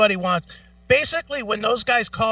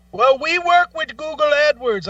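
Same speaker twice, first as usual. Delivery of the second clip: imitated telemarketer; 8 semitones higher, 4 dB louder than usual.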